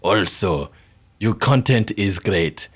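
ordinary delivery

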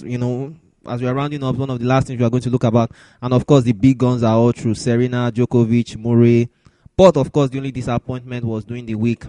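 ordinary delivery

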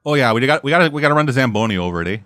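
quick mock-Canadian patter; Canadian accent. Local explaining to visitors about the ice, mock Canadian accent. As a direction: chatter like a cartoon Canadian. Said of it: "if that's not Canada".

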